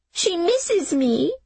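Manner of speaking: put-on high, slow child's voice; pleading little boy. Jamie begging Miss Smith to let him go home. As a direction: beg like a small boy clinging on. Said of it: "Jamie said".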